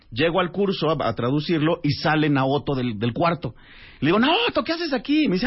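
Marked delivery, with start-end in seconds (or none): 4.2-5.3 high, stretched-out cry of recognition; surprised greeting. Retold or imitated. Running into Naoto at the course, astonished greeting. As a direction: re-enact bumping into someone unexpectedly. Unexpectedly meets acquaintance in Barcelona.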